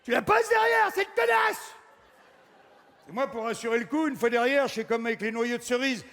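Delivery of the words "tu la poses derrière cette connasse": high, loud furious shout; triumphant yell. Acted. Flicking the ball away, a loud high shout. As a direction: yell it out as you get it.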